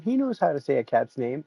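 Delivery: ordinary talk, nothing marked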